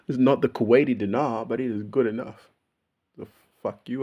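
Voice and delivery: ordinary talk, nothing marked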